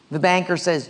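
ordinary talk, nothing marked

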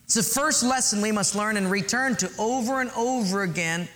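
ordinary talk, nothing marked

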